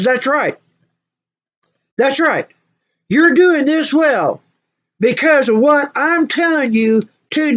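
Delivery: high, emphatic dressing-down; stern doctor's lecture. Retold or imitated. Doctor demanding the patient come every month, stern scolding. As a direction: lay down the law, word by word, pitched high.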